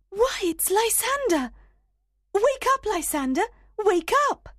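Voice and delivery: high urgent calling. Acted. Helena calling to wake him.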